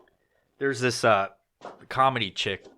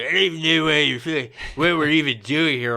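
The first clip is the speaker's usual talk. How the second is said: fast, high, louder mock drunk rambling; drunk girl; 5 semitones higher, 5 dB louder than usual. Imitating the drunk promo girl rambling at him, fast and high. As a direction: babble it like you're wasted. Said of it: "She's like".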